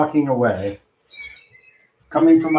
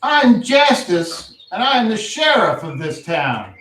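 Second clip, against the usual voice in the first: sheriff voice; 6 semitones higher than usual. Acted. GM voices sheriff introducing himself.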